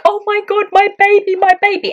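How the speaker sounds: high, loud, overwhelmed exclamation; overjoyed cry. Retold or imitated. Mother grabbing her newborn up, overjoyed cry. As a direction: cry out as if seizing your newborn.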